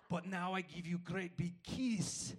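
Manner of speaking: put-on light Russian accent in broken English, said with a laugh. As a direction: toss off a playful Russian-accent line. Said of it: "he had a very thick Russian accent".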